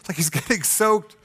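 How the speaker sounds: ordinary delivery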